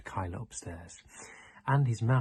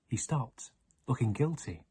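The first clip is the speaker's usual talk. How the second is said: usual speaking voice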